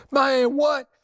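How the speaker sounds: high, indignant yelp of disbelief; mock outraged gangster; shocked protest. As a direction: squeal like you just got betrayed. Arrested accomplice protests.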